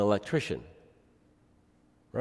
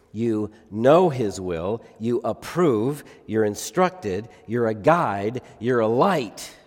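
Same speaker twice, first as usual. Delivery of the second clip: slow, punching "will", "approve", "guide" and "light"; emphasized words; slower than usual. Shown as a demonstration. Preacher re-reading the verse, stressing "guide" and "light" slowly. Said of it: "I was trying to emphasize the words".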